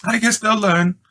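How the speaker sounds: high lightly voiced lady; a mother shrugging off her sons; lady's voice. A narrator's reading. Mrs Budwing sighs about sons.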